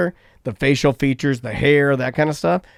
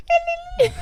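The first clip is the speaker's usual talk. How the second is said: very high, soft squeak; comic squeal for something little; tiny squeaky noise; 6 dB quieter than usual. Retold or imitated. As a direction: make a teeny high-pitched flapping sound effect. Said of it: "Little penis".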